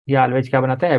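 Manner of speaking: normal talking voice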